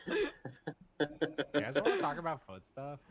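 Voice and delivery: giggling through the question; laughing. Real, not acted. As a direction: ask it while cracking up. Laughing at the foot plug.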